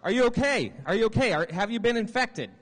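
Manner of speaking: high, loud, urgent questioning; hammed-up frantic concern; acted alarm. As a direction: fire worried questions at a survivor. Acted alarm at possible infection.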